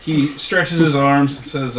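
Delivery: ordinary talk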